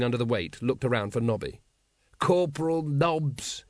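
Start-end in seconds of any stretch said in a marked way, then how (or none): 2.2-3.7 slow, rasped reprimand. A narrator's reading. Captain calls his corporal to account, rasping. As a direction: rasp out the name as a rebuke. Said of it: "he rasped".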